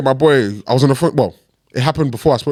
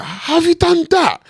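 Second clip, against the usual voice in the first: mock confrontation; slower than usual. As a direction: call out the driver in disbelief. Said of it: "you know when people".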